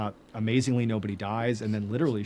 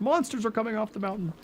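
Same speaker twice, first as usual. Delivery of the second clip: put-on frightened little girl; 9 semitones higher than usual. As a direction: imitate a girl warning her parents. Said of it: "says to her parents".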